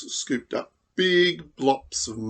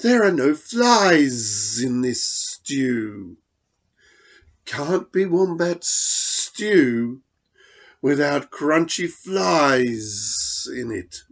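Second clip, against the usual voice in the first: slow, louder hiss; hissing lizard; 3 dB louder, slower than usual. Acted. Blue Tongue scolds Dingo, slow hissing lizard. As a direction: drag the words out like a lizard.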